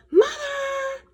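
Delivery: high, loud shrill call for mother. A narrator's reading. Girl yells for mother to tattle on brother. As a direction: yell for mom to tattle.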